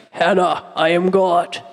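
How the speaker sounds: deep, slow manly voice; God. Acted. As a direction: put on a booming-style low voice, drawn out. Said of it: "just, like, a deep voice".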